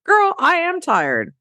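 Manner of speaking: put-on high, fed-up "Girl, I am tired"; exasperated listener. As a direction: whine it like a worn-out friend. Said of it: "some of you are going".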